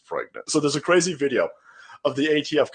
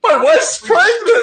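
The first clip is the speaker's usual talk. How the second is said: high, slow, laughing echo; laughing disbelief; 5 dB louder, slower than usual. Real, not acted. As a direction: repeat it cracking up in disbelief. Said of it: "Where did that come from?".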